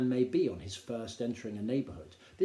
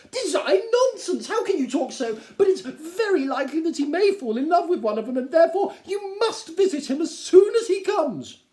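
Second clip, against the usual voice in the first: much higher and louder, indignant; scoffing Mrs Bennet; 11 dB louder than usual. Acted. Wife scoffing at husband, high and indignant. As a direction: brush him off, then plead.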